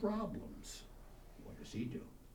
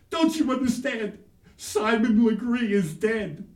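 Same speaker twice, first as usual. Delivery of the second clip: loud, high tearful wail; hammed-up childish anguish; mock crying child; 5 semitones higher, 8 dB louder than usual. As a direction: wail it like a distraught little boy. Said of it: "and he was crying".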